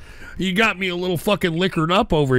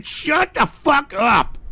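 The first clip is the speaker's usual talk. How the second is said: angry yell; 8 semitones higher, much slower than usual. Real, not acted. Host repeats angry order.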